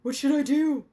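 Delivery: loud, high, panicked plea; growing panic. A narrator's reading. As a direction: beg friends for help in a panic. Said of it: "Lawrence's voice grew more panicked".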